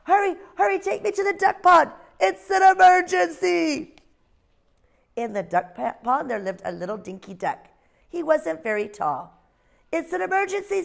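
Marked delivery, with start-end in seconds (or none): 0.0-4.0 much higher, louder owl voice crying out; a tiny owl pretending there's an emergency; little owl's alarm. Ozzie urging the lamb. 9.9-10.8 a tiny owl pretending there's an emergency; little owl's alarm. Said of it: "said Ozzie".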